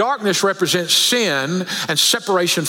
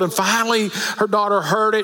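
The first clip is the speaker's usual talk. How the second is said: ordinary talk, nothing marked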